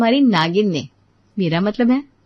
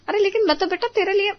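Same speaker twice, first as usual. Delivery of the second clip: high hurried protest; 11 semitones higher, 4 dB quieter, faster than usual. Acted. Mother protests to scolding son.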